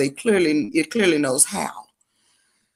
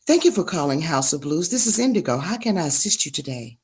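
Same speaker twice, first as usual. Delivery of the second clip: soft, polished receptionist greeting; office phone voice; 3 semitones lower, 4 dB quieter than usual. Shown as a demonstration. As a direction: answer the business line, quiet and professional. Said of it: "See, it's more like this".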